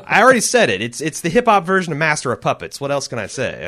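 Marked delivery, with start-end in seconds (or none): 0.0-0.8 laughing out the words, higher and louder; amused laugh at your own repetition. Real, not acted. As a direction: break into a laugh as you admit it. Laughing at repeating himself.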